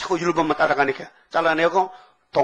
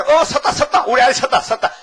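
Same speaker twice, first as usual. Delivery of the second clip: higher, louder, laughing cheer; mock delighted parent; 7 semitones higher, 4 dB louder than usual. Preacher imitates a parent thrilled their baby stands. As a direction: celebrate the baby's first steps.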